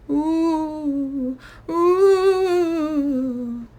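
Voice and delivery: rising, loud hollow moans; ghostly moan. Acted. The third spook moaning at the campers and Pa.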